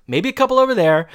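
high, loud, quick aside; mock admission. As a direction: squeak out a hammed-up confession. Retold joking admission.

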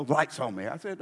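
ordinary delivery

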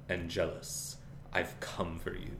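menacing Holtz. Retold or imitated. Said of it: "He would say".

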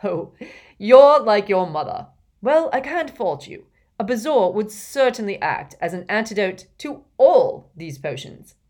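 put-on loud, hearty old professor; jolly professor voice. A narrator's reading. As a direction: praise him heartily. Slughorn praises Harry.

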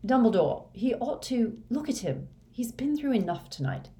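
high-pitched, protesting plea; pleading woman. A narrator's reading. McGonagall to Dumbledore about exhausted Harry, high pleading protest.

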